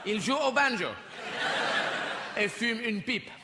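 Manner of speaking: lively mock French commentator narrating; playful mock French play-by-play; mock French commentary. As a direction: gabble it like an excited French film narrator.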